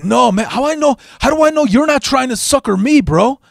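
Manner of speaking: put-on high, suspicious Cheech-style Mexican accent; Mexican accent impression. Thief suspects he's being conned, high Mexican accent. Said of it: "that typical Cheech Mexican accent".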